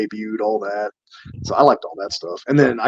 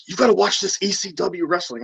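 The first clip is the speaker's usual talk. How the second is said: higher, eager friend; excited buddy; 7 semitones higher than usual. Retold or imitated. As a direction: play an excited school friend. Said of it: "he was like".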